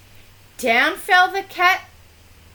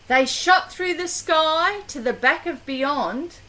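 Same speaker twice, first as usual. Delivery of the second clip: ordinary delivery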